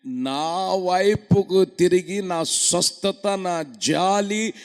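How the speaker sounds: loud, drawn-out sermon cry; fervent preaching. Acted. Preacher reading scripture aloud to the church, fervently. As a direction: stretch each phrase like a prophet.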